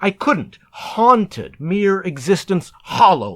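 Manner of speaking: plain reading voice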